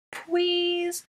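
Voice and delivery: very high, sustained begging; babyish, drawn-out high whine; pleading child. A narrator's reading. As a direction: plead like a small child, lisping "please". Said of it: "he pleaded".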